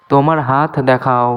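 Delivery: usual speaking voice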